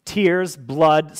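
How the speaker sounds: ordinary talk, nothing marked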